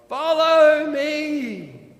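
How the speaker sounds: slow, loud, drawn-out call; slow called-out command. Acted. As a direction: call it out slowly to the whole group. Mister T leads the cars.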